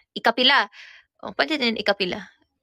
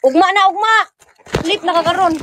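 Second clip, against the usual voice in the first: scolding; 9 semitones higher, 9 dB louder than usual. Real, not acted. Scolding child.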